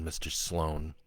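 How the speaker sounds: plain reading voice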